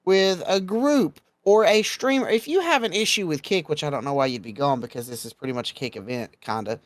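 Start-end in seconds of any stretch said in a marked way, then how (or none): 0.0-3.7 slow, raised-pitch warning; firm, deliberate admonishment; emphatic lecturing. Real, not acted. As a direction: lay down the rules, stretching the key words. Laying down rules for convention goers.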